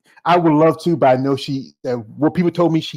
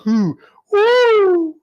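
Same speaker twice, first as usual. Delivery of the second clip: high-pitched "whew, woo" whoop; 3 dB louder than usual. Real, not acted. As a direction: let out a long, high whoop. Reacting to a risqué topic.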